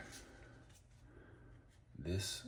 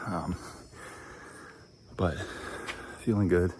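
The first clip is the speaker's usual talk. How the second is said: out of breath; 4 semitones higher, 10 dB louder than usual. Real, not acted. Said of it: "a little out of breath".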